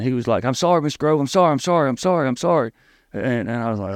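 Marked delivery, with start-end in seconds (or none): 0.4-2.7 higher-pitched, mildly panicked apology; imitating someone frantically apologizing; retold panic. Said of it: "And he was panicking".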